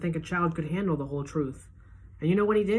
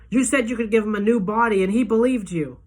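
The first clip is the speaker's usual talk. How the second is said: higher, louder accusing cry; angry accusation; 6 semitones higher, 7 dB louder than usual. A narrator's reading. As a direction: hurl it at the doctor. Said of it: "I say".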